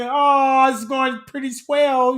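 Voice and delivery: hammed-up polite chatter; prim polite voice. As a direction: put on a wholesome suburban tone. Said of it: "I'm like".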